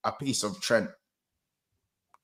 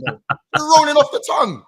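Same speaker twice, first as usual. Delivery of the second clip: ordinary talk, nothing marked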